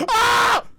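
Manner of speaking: very high, loud startled scream; gasp and scream. Real, not acted. Streamer screams in real fright at a jump scare.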